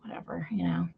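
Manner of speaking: a soft, low, throwaway whisper; dismissive whatever. Retold or imitated. Telling a friend how she shrugged off sermons, a low dismissive whisper. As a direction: brush it off under your breath. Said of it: "I'd just be like".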